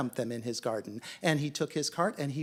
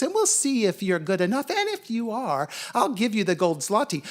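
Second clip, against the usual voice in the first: demanding dog; 3 semitones higher, 8 dB louder than usual. A narrator's reading. Dog lays down demanding terms.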